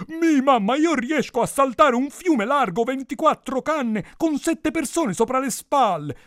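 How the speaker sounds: high, loud, slow simpleton boast; hammed-up dim son showing off; foolish first son. A narrator's reading. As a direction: boast to mamma in a silly high voice. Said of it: "sette figli scemi".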